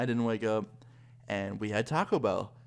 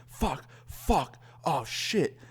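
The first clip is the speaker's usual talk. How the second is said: panicked cursing; 5 semitones higher, much slower than usual. Retold or imitated. Friend sees cop lights, panics.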